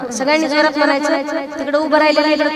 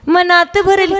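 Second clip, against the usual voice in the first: mock old-woman echo of a bhajan line; mimicked old woman; 3 semitones higher, slower than usual. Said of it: "म्हातारी म्हणे". (म्हातारीची नक्कल)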